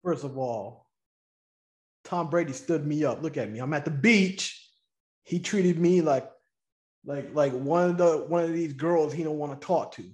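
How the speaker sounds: lightly voiced stood-up date, low; jilted beach date. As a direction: play the one left waiting at the beach, low and wounded. Whole turn in put-on lowered voice.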